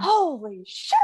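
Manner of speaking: high-pitched, stunned exclamation; squeaky astonishment; retold shock. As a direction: act out being floored by news. Shocked reaction to twins, retold.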